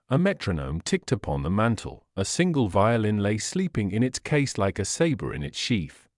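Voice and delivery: ordinary delivery, nothing marked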